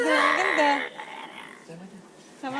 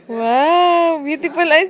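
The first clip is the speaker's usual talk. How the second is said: excited praise; 10 dB louder, much slower than usual. Real, not acted.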